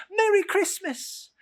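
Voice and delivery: high, loud festive call; bright holiday shout across the road; shouted greeting. A narrator's reading. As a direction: call it out like a cheerful boy. Boys shouting greetings at crossroads.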